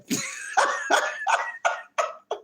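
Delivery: high, breathless giggling fit; real helpless laughter. Real, not acted. Streamer cracking himself up, real high laughter. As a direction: crack up at your own joke.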